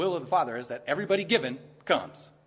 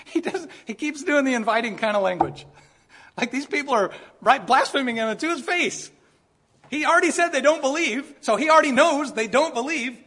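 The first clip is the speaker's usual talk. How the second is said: higher, louder pulpit fire; fervent preaching; 9 semitones higher, 6 dB louder than usual. Acted. Pastor marvelling at Jesus' patience, fervent raised preaching. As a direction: preach it with rising heat.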